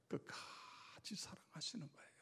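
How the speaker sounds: soft unvoiced whisper of the key line. Shown as a demonstration. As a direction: breathe it like a secret. Whispered repeat of key phrase.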